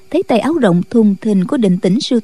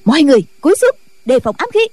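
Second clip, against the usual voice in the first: a mildly raised, higher command; urgent shout; 4 semitones higher, much faster than usual. Acted. Nghi Hòa orders the nuns to duck, urgent call. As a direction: order everyone down. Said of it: "la lớn".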